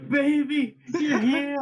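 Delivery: high, loud, laughing squeal of greeting; hammed-up high-pitched cry to a sweetheart; playful scream. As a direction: scream it out in mock-lovestruck delight. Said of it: "just gonna scream out".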